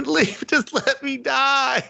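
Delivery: high, slow, strained dying voice; feeble dying man. Retold or imitated. Mocking the captive man begging to be left to die, high and slow. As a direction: beg weakly to be left to die. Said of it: "He's just like".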